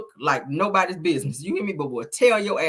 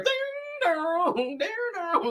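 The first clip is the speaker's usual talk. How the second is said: doorbell imitation; 11 semitones higher, 5 dB quieter, much slower than usual. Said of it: "ringing the doorbell".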